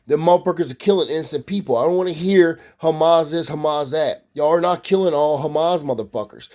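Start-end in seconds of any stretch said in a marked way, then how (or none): none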